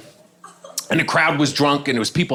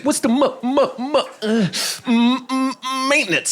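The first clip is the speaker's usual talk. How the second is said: high-pitched, repeatedly blocked stammer; worsening mock stutter; 9 semitones higher, slower than usual. Man questioning the realtor, worsening mock stutter. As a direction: get badly stuck on the M, pitched high. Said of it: "the stutter kept getting worse and worse".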